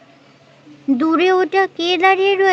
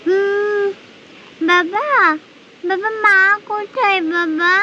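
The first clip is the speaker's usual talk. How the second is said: put-on young girl; 3 semitones higher than usual. Narrator voices young girl Poli.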